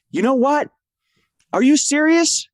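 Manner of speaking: high-pitched mock indignant wrestler; Penta impression. Wrestler confronts his rival on the microphone, high mock challenge. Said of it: "here he goes and he says".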